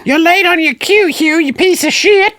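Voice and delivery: very high, put-on voice of a scolding director; shrill director tirade. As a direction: squeal it out as a bossy theater director.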